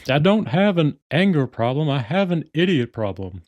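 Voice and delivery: mock-angry cartoon dad, high and loud; hammed-up irate father impression; Hank Hill. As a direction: protest in a put-on, exasperated dad voice. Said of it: "starts screaming at them".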